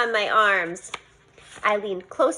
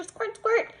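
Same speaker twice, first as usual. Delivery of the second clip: high squirt noises; 9 semitones higher, 3 dB quieter than usual. A narrator's reading.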